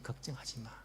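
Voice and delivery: soft unvoiced whisper of reassurance; the Lord whispering to the struggling poor; whispered comfort. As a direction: breathe it like a secret promise. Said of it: "주님께서 말씀하십니다".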